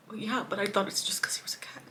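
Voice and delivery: voiceless, regretful whisper. Real, not acted. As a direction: breathe it out like a confession to yourself. Grieving self-reproach.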